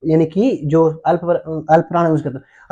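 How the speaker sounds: ordinary talk, nothing marked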